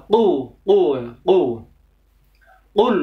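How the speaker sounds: "qu" drilled with a throat q; over enunciating. Shown as a demonstration. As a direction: repeat "qu", q from the throat. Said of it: "Jadi tidak cukup dibaca".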